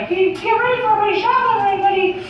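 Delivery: fast, high cartoon falsetto; high, hurried cartoon announcement; Mickey Mouse. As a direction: rattle it off in a mouse squeak. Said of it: "Mickey gets this brilliant idea".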